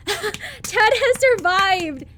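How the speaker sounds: slow joyful laughing-cry; celebrate, laughing through it; laughing excitedly. Real, not acted. Joy at surviving game.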